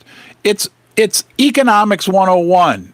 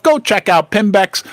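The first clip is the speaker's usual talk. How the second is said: ordinary delivery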